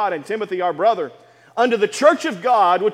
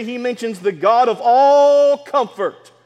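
"all" slowed and leaned on; emphasis on all; 2 semitones higher, 4 dB louder, slower than usual. Shown as a demonstration. Pastor points the church to the word "all", stressing it. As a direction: hit the word "all" in "the God of all comfort". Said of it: "Emphasis on that word all".

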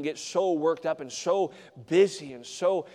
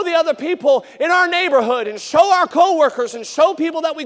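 loud, high, driving sermon delivery; fiery preacher calling the church to act; preaching at full force; 12 semitones higher, 11 dB louder than usual. Acted. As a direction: hammer the point home to the congregation. Preaching builds.

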